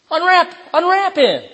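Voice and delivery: high, ringing command; commanding Jesus. Retold or imitated. Jesus orders the people to unwrap Lazarus, high ringing command. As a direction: proclaim it like a prophet ordering the crowd.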